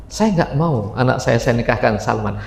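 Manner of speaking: father refusing. Acted. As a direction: turn the request down low and steady.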